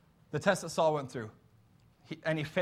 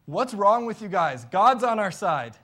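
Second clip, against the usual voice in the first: bold shout; 3 semitones higher, 8 dB louder than usual. Retold or imitated. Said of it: "And instead he says".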